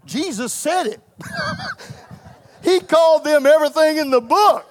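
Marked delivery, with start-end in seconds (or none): 1.2-1.9 squeaky unvoiced laugh; high breathy laugh. Real, not acted. Preacher giggling at the congregation. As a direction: burst into a giggle.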